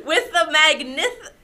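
slow, giggling through the word; can't get the word out for laughing; hiccup giggles. Real, not acted. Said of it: "I can't stop laughing long enough".

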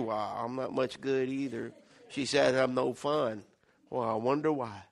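mopey sulker droning low; mopey person. Acted. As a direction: sink into a deep glum mumble. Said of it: "You ever see a mope person?".

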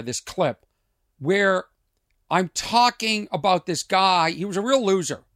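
usual speaking voice

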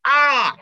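sharp high cry of alarm. Retold or imitated. Father crying out at news of a lion. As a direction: yelp like a startled father.

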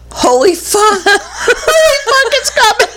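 high squealed panic through laughter; laughing panic. Retold or imitated. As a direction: retell the panic, cracking up as it climbs. Retold panic, voice breaking into laughter.

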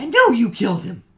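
high, loud accusing shout; furious accusation. Acted. Grieving mother shouting at the player she blames. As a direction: yell it at the killer.

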